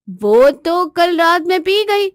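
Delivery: airy drawn-out excuse; unapologetic wife. Acted. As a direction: confess slowly without remorse. Wife confesses she drank it all.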